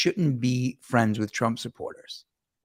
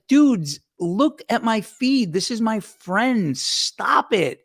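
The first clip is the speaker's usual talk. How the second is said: higher-pitched, drawn-out protest; retold exasperation, pitched up; exasperated pleading retort; 6 semitones higher, slower than usual. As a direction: plead with them to back off. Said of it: "And I was like".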